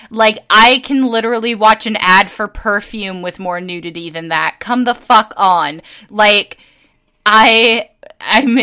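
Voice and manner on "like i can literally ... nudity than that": ordinary delivery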